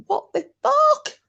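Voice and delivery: high, slow mock shocked cry; hammed-up disbelief, high and drawn out; shocked girlfriend. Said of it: "the girlfriend goes".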